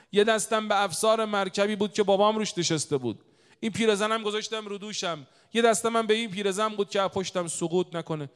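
loud, high-pitched narration; preacher at full force; impassioned sermon storytelling. Real, not acted.

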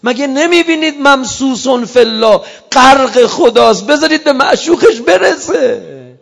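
loud, high cry to let him go; preacher voicing Imam Hussein's high, loud shout; anguished shout. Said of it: "یه دفعه امام حسین داد زد".

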